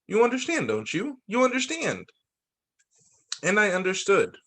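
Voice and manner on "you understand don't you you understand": the teacher's voice lifting high with joy; joyful teacher. Acted. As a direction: she finally got it, let it soar. Teacher realizing Helen understands.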